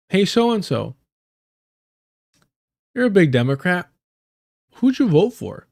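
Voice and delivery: imitating homegirl. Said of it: "the homegirl goes".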